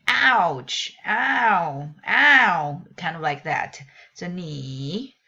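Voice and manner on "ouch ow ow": loud ouch ow ow like a small hurt; teacher likening a tone to a cry of pain; mock pain cry. Shown as a demonstration. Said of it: "in English we say".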